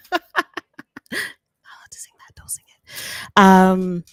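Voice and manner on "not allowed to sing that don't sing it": hushed, secretive whisper of a forbidden rule; mock whisper. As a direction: whisper it like a kid told off. Forbidden song, parent's rule.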